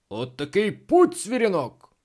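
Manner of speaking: loud, high outburst; exclaiming captain. A narrator's reading. Captain wanting to chase the boy off, loud exclamation. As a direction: cry out at the cheeky boy. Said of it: "вигукнув капітан".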